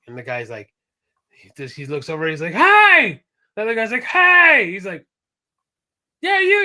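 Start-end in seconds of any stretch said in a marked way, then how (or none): 2.5-3.2 high, loud holler; comic shouted hail; calling out. Retold or imitated. Acting out men yelling across island. 4.0-4.7 high, loud holler; comic shouted hail; calling out. Retold or imitated. Other man yells back. 6.2-6.6 high, loud holler; comic shouting match; shouted retort. Retold or imitated. Retold shouting match between gamers.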